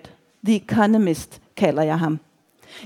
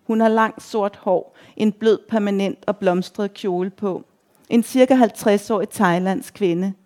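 ordinary delivery

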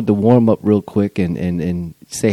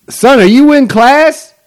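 loud, high call through the house; 10 dB louder, slower than usual. Real, not acted. Dad calling to his son in class, loud call. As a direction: call out to the son in the next room.

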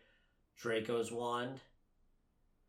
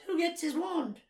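high, squeaky house-elf impression; Dobby. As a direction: put on a little elf's high-pitched voice. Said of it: "That was almost a good impression".

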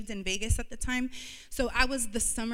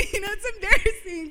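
higher, louder and faster, laughing through it; embarrassed laugh; 12 semitones higher, 10 dB louder, faster than usual. Real, not acted. Telling the church it's embarrassing, laughing and higher.

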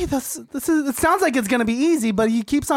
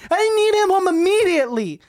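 angry shout; 6 semitones higher than usual. Acted. Same request shouted.

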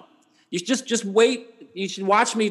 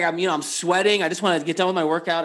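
ordinary talk

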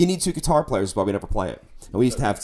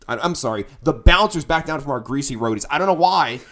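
usual speaking voice

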